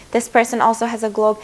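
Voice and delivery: ordinary delivery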